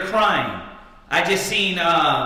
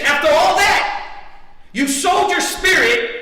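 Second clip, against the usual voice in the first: high, raised preacher's cry; emphatic preaching; 8 semitones higher than usual. Real, not acted. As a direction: hit the congregation with the charge. Preacher delivers climactic accusation.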